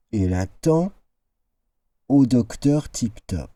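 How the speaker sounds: ordinary delivery, nothing marked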